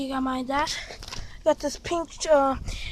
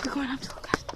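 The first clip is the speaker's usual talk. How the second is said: whispering; 4 semitones lower, 14 dB quieter, faster than usual. Real, not acted. Sneaking up, whispering.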